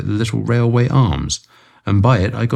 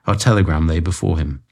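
plain reading voice

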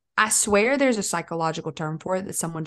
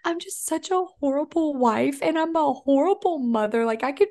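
much higher mock-whiny wife; hammed-up high self-pity; funny voice; 8 semitones higher than usual. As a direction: wail it in a squeaky cartoon voice. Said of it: "I'll do a funny voice".

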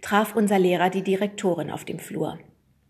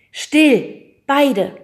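commanding; 7 semitones higher, 10 dB louder than usual. A narrator's reading. Hannah silences the two quarrelling boys, commanding loud. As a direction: bark the order at the two boys. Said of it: "befahl Hannah".

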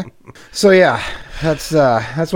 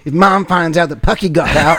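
mock panicky guy; 4 semitones higher, 5 dB louder than usual. Voicing the panicking dog owner.

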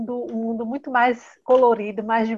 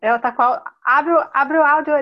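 loud, higher call to unmute; raised voice; 4 semitones higher, 7 dB louder than usual. Real, not acted. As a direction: call out so she can hear. Guest's audio is muted.

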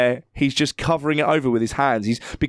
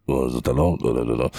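much lower, muted talk; low public voice; faster than usual. Retold or imitated. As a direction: go low and offhand. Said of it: "he's like".

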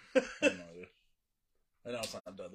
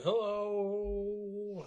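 high, stretched hello with long O's; drawn-out hello; 8 semitones higher, 5 dB louder than usual. Retold or imitated. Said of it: "that's how she said it, too, with a bunch of O's".

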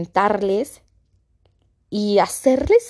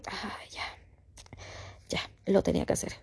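whispery sigh of release; hushed, spent relief; relieved exhale; 11 dB quieter than usual. Acted. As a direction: breathe it out, barely voiced. Acting the relief of crying.

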